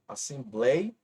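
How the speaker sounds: assembleia said slowly, each syllable clear, stress on the bléi; slow, careful assembleia with the stress on the penultimate bléi; careful spelling-out. Shown as a demonstration. Teacher showing the class how assembleia sounds, slow and clear. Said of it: "a escrita correta é assim".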